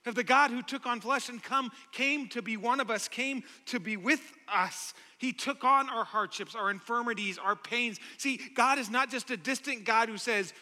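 usual speaking voice

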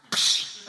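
sharp, voiceless "psh" of a slap; mimicked smack, made with the mouth; slap sound. Shown as a demonstration. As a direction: imitate a slap with a burst of air. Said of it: "Everyone just go".

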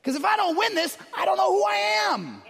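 high strained anxious plea; anxious panic. Retold or imitated. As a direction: panic about losing. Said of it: "we're like".

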